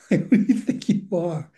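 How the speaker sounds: amused retelling of a put-down; chuckling mock indignation; laughing mock challenge. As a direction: repeat the challenge through a laugh. Repeats the put-down while laughing.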